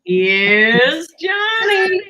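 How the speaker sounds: drawn-out, loud horror-movie announcement; Here's Johnny. Pretend. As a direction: stretch it out slow and loud. Quoting the Shining line.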